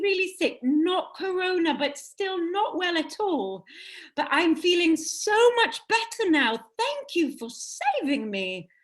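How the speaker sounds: grateful recovered prince in a hint of English accent. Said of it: "Let's make him English".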